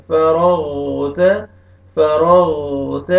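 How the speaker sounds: فرغت with heavy ر and a thick غ from its place; heavy ghain. Shown as a demonstration. Teacher models heavy letters in فرغت. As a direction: read فرغت with full heavy ر and غ. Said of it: "غین کو اپنے صحیح مخرج سے موٹا".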